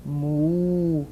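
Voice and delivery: long, drawn-out moos; imitated cattle lowing. A narrator's reading. Cattle stuck in the road, long lowing moos. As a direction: stretch each moo out like a cow. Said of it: "went the cattle".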